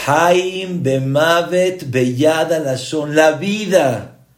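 drawn-out, emphatic scripture quote; proclaimed verse. Shown as a demonstration. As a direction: declaim the verse slowly and forcefully.

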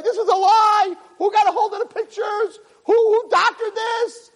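high, loud, outraged protest; Satan freaking out. Acted. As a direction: wail in mock outrage, stretching it out at the end. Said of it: "he's freaking out".

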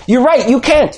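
ordinary talk

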